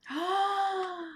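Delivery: sharp high gasp; surprised intake of breath; amazed gasp. Acted. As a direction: gasp at the shiny pearl. Oyster opened, pearl found.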